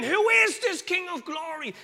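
high, loud, slow grand question; Shakespearean actor. Retold or imitated. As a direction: declaim it like a hammy stage actor. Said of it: "He's like a Shakespearean actor now".

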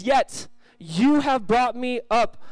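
drawn out, lifted and slow; slow scripture reading. Acted. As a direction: stretch the verse out as a proclamation.

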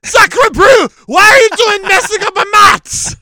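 put-on high, loud outraged French accent; french chef. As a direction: yell like an indignant French artist. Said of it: "I'm gonna knock at my door".

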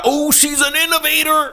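high-pitched, gushing mockery; mock admiration. Mocking the fans who praise [name], mock gushing.

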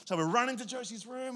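usual speaking voice

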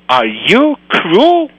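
a disbelieving, slow question; can't believe her ears, said slowly; shocked question. A narrator's reading. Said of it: "The Mora was in shock".

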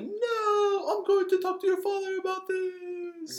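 high, slow whining threat; mock whiny Vader. Retelling Thumb Wars Vader, high whiny wail. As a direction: whine it like a tattling kid. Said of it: "Darth Vader's like".

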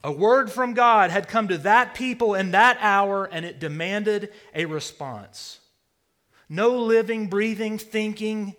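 full-force preaching peak; preaching shout. Real, not acted. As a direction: proclaim it to the back row. Preacher builds to a climax.

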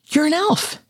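put-on very high little child voice; tiny, high-pitched kid squeak; squeaky kid. A narrator's reading. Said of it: "the kid squeaked".

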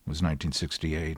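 normal talking voice

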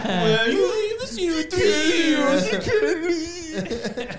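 high laughing squeal, slow. Real, not acted. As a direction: laugh it out in disbelief. Laughing reply to teasing.

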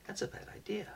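hushed repeat of the warning; whispered warning. Retold or imitated. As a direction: breathe it out, nearly silent.

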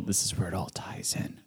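quick whispered aside. Real, not acted. Aside about the musical.

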